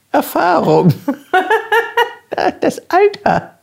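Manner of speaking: put-on high laughing old-age joke; mock wise elder. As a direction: squeak it out while laughing.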